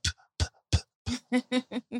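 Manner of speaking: imitating a toddler's little spit sounds; soft mock spitting pops; baby spitting noise. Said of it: "she was like".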